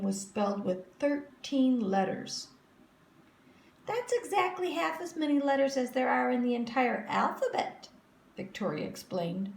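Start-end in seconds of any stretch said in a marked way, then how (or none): 3.8-7.9 put-on high, superior little girl; smug girl. A narrator's reading. Victoria boasting to the class, high and smug. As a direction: lift your nose and boast.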